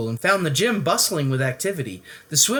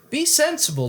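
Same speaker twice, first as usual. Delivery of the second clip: declaring; 14 semitones higher than usual. Acted. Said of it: "declared Lewis's parents".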